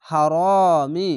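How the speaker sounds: হারামি with the first vowel held long and slowly; slow recitation. Shown as a demonstration. Teacher to students, reciting slowly for practice. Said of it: "যাওয়ার পাশে খালি আলিফ".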